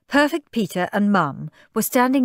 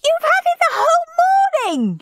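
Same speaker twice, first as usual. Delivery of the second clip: very high, loud squealing protest; piercing child's shriek; 5 dB louder than usual. A narrator's reading. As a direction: shriek it like an outraged small boy. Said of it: "shrieked Peter".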